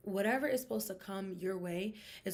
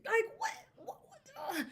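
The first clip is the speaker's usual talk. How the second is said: disbelief. Real, not acted. Disbelief at athletes' DUI.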